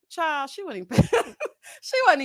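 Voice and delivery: laughing. Real, not acted. Breaks up mid-sentence.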